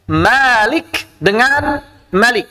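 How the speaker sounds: "Malik" with a long drawn a, then "malik" short; slow tajwid demo of "Malik" long versus short; contrasting long and short vowel. Shown as a demonstration. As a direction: stretch the first a of "Malik", clip the second. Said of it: "Beda nggak maknanya?".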